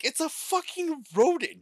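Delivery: high-pitched incredulous protest; exasperated mock outrage. Retelling his answer to Pikachu fans, exasperated mock outrage. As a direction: protest as if everyone has gone mad.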